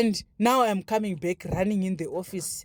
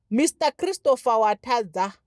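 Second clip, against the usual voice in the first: usual speaking voice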